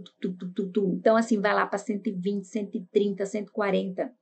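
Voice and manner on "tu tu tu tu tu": soft spoken heartbeat "tu, tu, tu"; heartbeat imitation. Shown as a demonstration. Teacher imitates a racing heart for students: soft "tu tu". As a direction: tap out the pulse with your voice. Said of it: "O teu coração".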